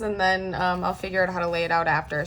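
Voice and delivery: usual speaking voice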